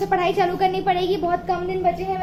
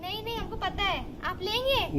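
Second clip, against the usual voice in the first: sweet vendor voice; 3 semitones higher, 13 dB quieter than usual. Pretend. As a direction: sell it sweetly to a buyer. Pretend seller. (ऊँची मीठी सब्ज़ीवाली आवाज़)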